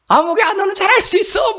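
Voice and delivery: high-pitched mock call coming back; imitated echo call. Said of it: "막 이렇게 와요". (높은 메아리 흉내로 되받는 격려)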